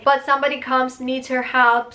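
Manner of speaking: normal talking voice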